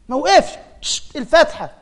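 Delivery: high, mock-admiring wonder at the feat; mock amazement. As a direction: gush over it in mock awe. Mocking the one-breath reciter. (إعجاب ساخر بصوت عالٍ)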